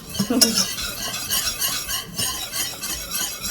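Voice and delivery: a soft, airy laugh with no voice; breathy laugh. Real, not acted. Cook laughing to viewers about old school paste, breathy laugh. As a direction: let out a light chuckle.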